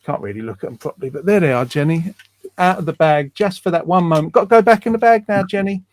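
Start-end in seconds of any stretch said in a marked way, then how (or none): 4.3-5.8 playful. Real, not acted. Playfully putting glasses away.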